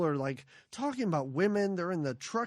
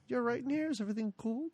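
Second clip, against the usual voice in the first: softly imitated tentative check-in; tepid question; 3 semitones higher, 8 dB quieter, much faster than usual. Man checking on Irina at her door, softly tepid. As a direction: ask it quietly, barely committing. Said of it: "it's the most tepid question".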